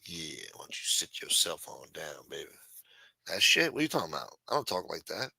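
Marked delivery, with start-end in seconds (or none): 0.0-2.5 low, soft, smooth lady-killer voice; Shaft accent. Pretend. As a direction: lean back and sweet-talk her, much lower. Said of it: "sometimes when I'm talking to Nita".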